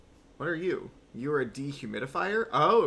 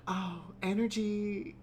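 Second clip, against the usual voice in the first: slow, airy mock wonder; dreamy mock awe at energy; 7 semitones higher, slower than usual. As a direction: gush it like a wide-eyed hippie. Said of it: "Sometimes I will hear people talking about".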